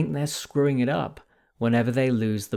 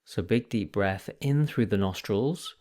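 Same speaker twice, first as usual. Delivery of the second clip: guided meditation; 2 semitones lower than usual. Acted. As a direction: ease the listeners gently into the practice, leaving space between phrases.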